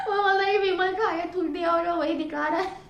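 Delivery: laughing through the words; barely holding back laughter. Real, not acted. Telling viewers the friend's joke, laughing hard. Laughing at boyfriend's fake vomit.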